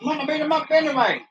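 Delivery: high, laughing tough-guy voice; mock Jeff Jarrett. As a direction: play the cocky wrestler while laughing. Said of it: "why Jeff Jarrett coming shirtless".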